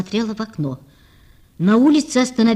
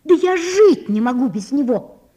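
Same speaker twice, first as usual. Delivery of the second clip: passionate cry, a little louder; 4 semitones higher, 4 dB louder than usual. A narrator's reading. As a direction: fling it at Sonya in despair. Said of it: "закричала Наташа".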